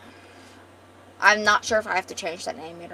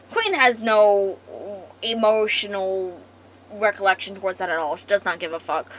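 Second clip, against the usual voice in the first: ordinary delivery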